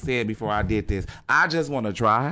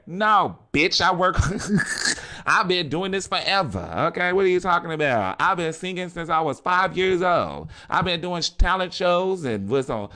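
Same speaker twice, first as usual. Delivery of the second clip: voicing [name]; 7 semitones higher than usual. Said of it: "now I'm talking about [name]".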